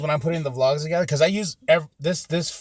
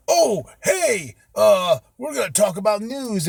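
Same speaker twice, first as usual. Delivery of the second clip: mock newscaster; 5 semitones higher, slower than usual. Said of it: "we're gonna be like".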